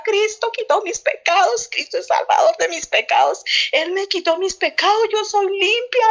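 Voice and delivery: high, fervent re-enacted outcry, not fully shouted; retold crying out. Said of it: "Yo empecé a gritar".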